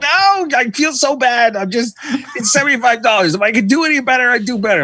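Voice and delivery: a French mechanic fretting over a small bill; French accent. Retold or imitated. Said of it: "Are we doing accents?".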